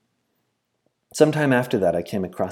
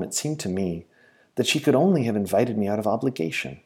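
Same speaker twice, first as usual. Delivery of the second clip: plain reading voice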